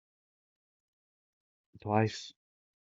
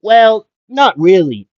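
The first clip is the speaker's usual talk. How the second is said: honest Twice; 10 semitones higher, 13 dB louder, slower than usual. Retold or imitated. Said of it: "And Twice would say".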